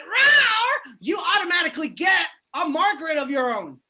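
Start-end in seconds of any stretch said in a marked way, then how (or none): 0.0-0.9 sudden high, loud roar. Pretend. Alien naming his birth planet, loud high roar. Roars planet name mid-sentence.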